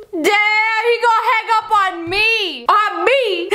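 high, giggly disbelief; laughing. Real, not acted. Laughing at the hang-up.

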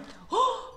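high, theatrical pretend gasp; mock gasp. Teacher pretends to the class a word is lost, mock gasp. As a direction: gasp as if something just fell.

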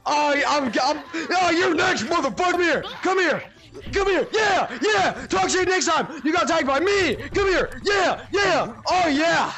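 high, loud gloating; hyped trash talk. Real, not acted. Chasing a player who got tagged, hyped gloating shouts. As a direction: shout taunts mid-chase. Taunting while chasing in tag.

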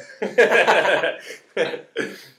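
a big burst of real laughter; loud laugh. Real, not acted. Cohost laughing hard at the joke, loud laugh.

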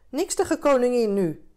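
loud, high, impatient retort; called out. A narrator's reading. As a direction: shout it back from the water. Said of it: "riep ze". (luid en ongeduldig roepen)

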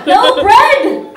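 high, loud comic yell; mirror yelling. Acted. Mirror yelling from the bathroom at Roxy, loud shout. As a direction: bellow it like a revolution slogan. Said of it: "yelled the mirror".

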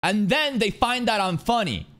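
ordinary talk, nothing marked

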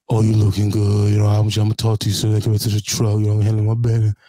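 low, loud put-on deep voice; young man deep voice. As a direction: push the voice down deep and smooth. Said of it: "my young man deep voice".

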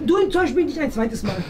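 ordinary talk

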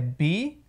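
"beer" broken slowly into BEE and UR; sílabas separadas. Shown as a demonstration. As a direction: draw out "beer" in two parts. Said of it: "Esse E R no final tem o som de er". ("beer" devagar, bi... ur)

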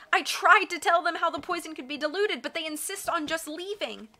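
high, urgent protest; high voice of a woman in labor; distressed. A narrator's reading.

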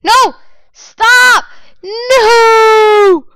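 loud, high shriek of no and stop; screaming no. Real, not acted. Player being killed, loud panicked scream. Attacked again.

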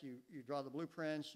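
normal talking voice

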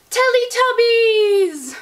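excited. Real, not acted. Excited answer to favourite show.

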